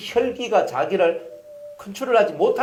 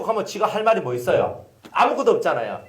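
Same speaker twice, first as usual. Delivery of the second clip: ordinary talk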